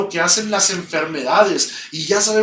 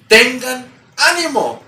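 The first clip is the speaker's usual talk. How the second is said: ánimo stretched out, higher and louder; rally them; emphatic encouragement; 6 semitones higher, 5 dB louder than usual. Acted. Preacher repeats Jesus' words to the church, a stirring call. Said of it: "¿Viste lo que les dijo?".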